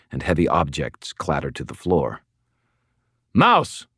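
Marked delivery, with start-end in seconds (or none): 3.3-3.9 high, sharp shouted command; shouted call, pitched way up. Acted. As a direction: shout the name to send someone charging. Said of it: "I shouted".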